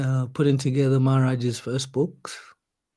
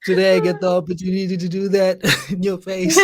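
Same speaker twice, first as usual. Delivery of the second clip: higher-pitched, breaking into real laughter; laughing; 6 semitones higher than usual. Real, not acted. Host teasing guest about the name joke, laughing. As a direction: let the laugh take over by the end.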